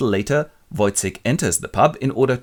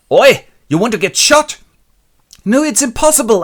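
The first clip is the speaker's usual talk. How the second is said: much higher, louder yells; mock shouting; 14 semitones higher, 10 dB louder than usual. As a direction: yell it out. Acted confrontation.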